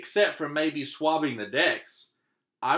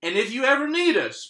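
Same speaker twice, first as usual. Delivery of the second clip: higher, louder hearty captain; hearty pirate captain; 10 semitones higher, 5 dB louder than usual. Acted. Captain bidding the boy farewell, hearty. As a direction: boom it out like a proud captain.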